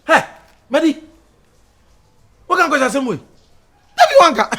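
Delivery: very high, loud outburst; loud, indignant challenge; outraged shouting. Acted. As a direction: shout it in high-pitched disbelief. Heated quarrel over an accusation.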